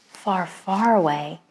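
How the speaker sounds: ordinary delivery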